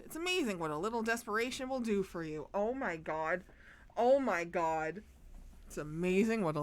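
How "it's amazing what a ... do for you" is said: quick rushed clerk line; fast game dialogue read. Acted. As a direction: rattle it off.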